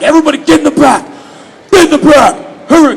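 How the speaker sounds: loud, high shouted commands; barked orders. Retold or imitated. Melanie ordering everyone to the back, shouting. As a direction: yell like a Navy drill officer. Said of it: "She was like".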